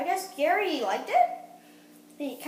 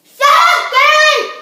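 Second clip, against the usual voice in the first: high, loud playful yell; 12 semitones higher, 18 dB louder than usual. Real, not acted. Kid demoing the icing packet, loud playful yell. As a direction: bellow it at the pastry.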